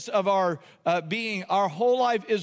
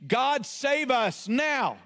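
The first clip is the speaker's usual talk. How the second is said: high, slow shouted plea; a slow shouted cry to be saved; desperate cry; 4 semitones higher, much slower than usual. Acted. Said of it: "here's what Hosanna means".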